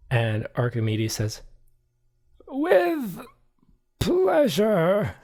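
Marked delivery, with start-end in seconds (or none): 2.5-5.1 high, stiff, forced politeness; a butler agreeing through his teeth; high, drawn-out reluctant acceptance; grudging butler. Acted.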